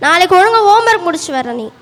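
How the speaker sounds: loud classroom telling-off; loud, stern homework reprimand; teacher's scolding. Acted. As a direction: scold the pupil loudly. Teacher scolds pupil over homework.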